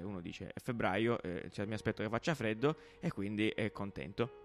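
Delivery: playful weather presenter voice; weather forecast read. Acted. Mock weather forecast segment.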